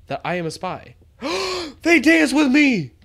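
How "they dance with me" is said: excited cry. Real, not acted. Delighted by ants dancing.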